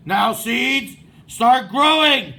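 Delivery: loud shout at the seeds. A narrator's reading. Toad shouts at his seeds, loud. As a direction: yell it down at the ground. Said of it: "and shouted".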